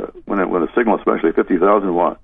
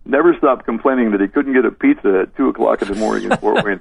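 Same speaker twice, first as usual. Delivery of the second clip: ordinary talk, nothing marked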